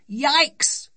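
put-on high, loud child's yelp of alarm; frightened shout. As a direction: shout it out like a startled little girl. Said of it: "shouted Sophie".

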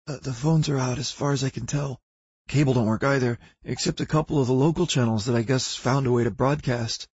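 a hint of a jittery teenage clerk; nervous young clerk.

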